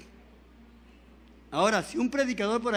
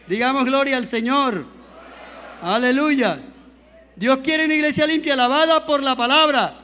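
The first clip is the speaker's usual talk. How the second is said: louder fervent preaching with slowed final cry; full-force preaching; 3 semitones higher, 7 dB louder than usual. Acted. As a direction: stir the church, calling for fire.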